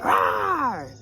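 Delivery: high playful growl of rage; mock monster rawr; mock angry roar. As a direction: let out a cartoon roar. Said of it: "I got mad".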